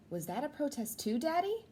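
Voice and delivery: put-on high, young child voice asking; a curious kid looking up at daddy; child's question. The child asks daddy about the Million Man March photo, in a high childlike voice.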